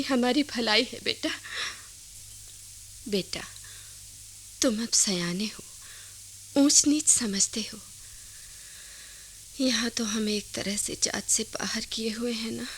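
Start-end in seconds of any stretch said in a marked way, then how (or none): 3.1-12.7 soft whisper, much quieter; whispered confiding. Acted. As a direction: tell him the shameful truth in a whisper. Mother confides secret to son. (धीमी फुसफुसाहट में राज़ बताना)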